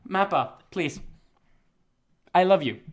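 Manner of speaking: ordinary talk, nothing marked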